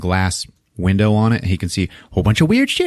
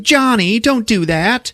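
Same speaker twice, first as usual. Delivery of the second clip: very high mock-protesting girlfriend voice; imitated girlfriend; 14 semitones higher, slower than usual. Girlfriend scolds boyfriend for scaring her, mock upset. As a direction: whine it in a put-on high voice.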